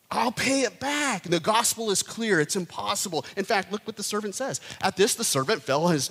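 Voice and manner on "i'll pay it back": a hint of a naive debtor, slow and high; mock pleading debtor. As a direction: play the deluded sinner promising. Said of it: "And how do we respond?".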